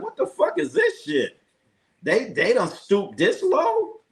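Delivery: high, incredulous outburst, slowing at the end; mock horror at what's on TV; outraged disbelief. Retelling his reaction to wrestling on ESPN, high incredulous outrage.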